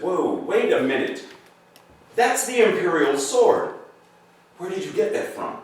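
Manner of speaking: high, loud startled challenge; acted astonishment. Sword master spots the imperial sword, astonished. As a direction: play the stunned double take, pitch jumping up.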